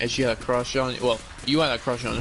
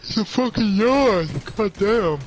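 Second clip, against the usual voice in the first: high, loud indignant protest; annoyed outburst; 9 semitones higher, 5 dB louder than usual. Real, not acted. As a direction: insist it was only a yawn, loudly.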